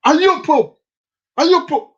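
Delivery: high, loud accusing question; accusing Miss Jean. Retold or imitated. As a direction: demand it like a stern woman scolding a child. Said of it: "Miss Jean, and you accuse me".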